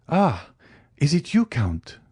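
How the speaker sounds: a touch of joy at the meeting; almost joyful. Acted. Young man greets the Count, a hint of joy. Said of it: "in an almost joyful accent".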